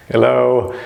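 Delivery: acted hail to someone not responding; calling for attention. King calls again, gets no answer.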